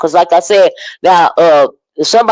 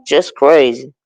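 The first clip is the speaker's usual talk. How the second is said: normal talking voice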